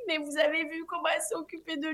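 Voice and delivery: high, fast, tearful complaint; imitating her own hormonal crying, high and fast; mock tearful wail. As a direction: whine it like a sobbing new mum. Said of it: "je me mets à chialer de ouf".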